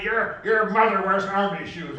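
loud, high shouted insult; husband's insult. Retold or imitated. Husband shouting an insult at his wife in a fight. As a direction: yell it mid-fight with a laugh. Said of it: "he says".

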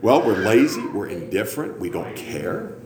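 usual speaking voice

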